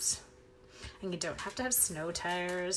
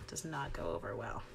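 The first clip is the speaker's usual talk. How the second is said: much lower, breathy whispered aside; 10 semitones lower, 8 dB quieter than usual. Real, not acted. Teacher joking about her principal, hushed aside. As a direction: mutter it as a private joke.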